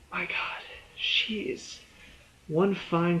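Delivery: breathless whisper of admiration; hushed swoon over an actress; awed whisper. Real, not acted. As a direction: whisper it like you can't believe it.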